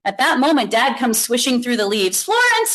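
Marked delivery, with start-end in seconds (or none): 2.2-2.7 calling out. Acted. Dad calling his daughter.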